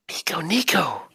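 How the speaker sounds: high breathless whisper of the names; awed whisper. Acted. Spirit startled by the names.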